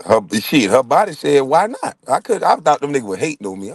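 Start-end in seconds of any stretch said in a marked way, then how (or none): 1.4-2.0 higher, playful invite; cheeky come-on. Retold or imitated. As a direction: tease him into it. Body's invitation retold, louder.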